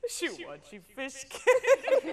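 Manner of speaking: slow, high, squeezed out between laughs; quoting the lyric while cracking up; laughing. Real, not acted. Host repeats the song lyric while laughing. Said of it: "I am dying here on set".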